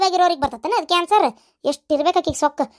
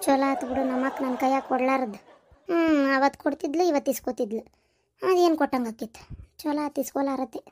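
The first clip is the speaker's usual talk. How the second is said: much softer, lower sly grumble under the breath; muttered aside; 5 semitones lower, 7 dB quieter than usual. Acted. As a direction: mutter it so nobody hears, with a chuckle. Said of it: "ಏನ ಅಂದಿ?". (ಮೆಲ್ಲಗೆ ಗೊಣಗುವ ಪಕ್ಕದ ಮಾತು)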